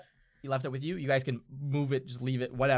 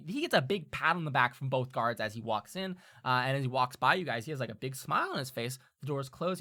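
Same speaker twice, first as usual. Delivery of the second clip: usual speaking voice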